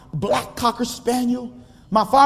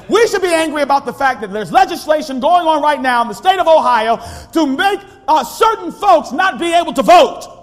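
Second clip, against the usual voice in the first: preacher climbing from firm to a shout; preaching at full force; 5 semitones higher, 5 dB louder than usual. Acted. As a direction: rise to a fiery pulpit shout.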